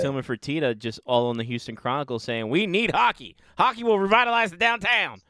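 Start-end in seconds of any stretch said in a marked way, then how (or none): 2.5-5.2 high, loud cartoon proclamation; cartoony voice. Host imitating a would-be Houston team owner, cartoony voice. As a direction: belt it out like a cartoon tycoon, pitched way up. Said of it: "that cartoony of a voice".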